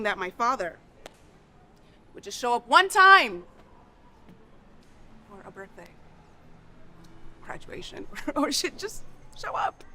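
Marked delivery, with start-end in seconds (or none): 2.1-3.4 loud, raised-pitch cry of hurt; anguished outburst. Acted. Child wishing father had shown up, anguished. Pleading over the absent father. 5.3-8.1 very soft, fading to unvoiced breath; deflated hurt. Child listing father's missed events, deflated. Hurt fades after the outburst.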